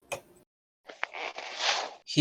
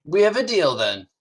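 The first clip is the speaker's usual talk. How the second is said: first mate; 7 semitones higher, 4 dB louder than usual. Acted. First mate closing the deal.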